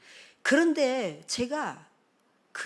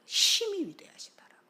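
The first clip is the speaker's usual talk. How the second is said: whispered repeat; 11 semitones higher than usual. Real, not acted.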